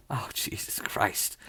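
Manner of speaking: breathless whisper of dismay; barely voiced, shaken. Real, not acted. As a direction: whisper it in disbelief. Reacting to painful testimony.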